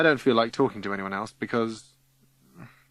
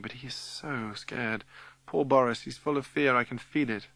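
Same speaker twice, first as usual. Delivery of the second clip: ordinary delivery, nothing marked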